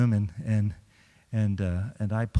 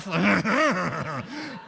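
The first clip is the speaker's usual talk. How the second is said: high laughing burst; demo laugh; 6 semitones higher than usual. Shown as a demonstration. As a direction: keep the laugh going. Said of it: "just laugh".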